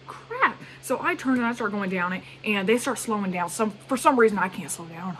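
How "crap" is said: sudden high yelp of dismay; a high mock-panicked crap. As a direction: squeak it out as it dawns on you. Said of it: "So I was like".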